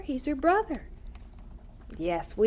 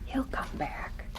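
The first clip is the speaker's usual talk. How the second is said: hushed, slow and much softer; slow whisper; 5 semitones higher, 9 dB quieter, slower than usual. Acted. Mother promising her daughter the brother returns, slow whisper.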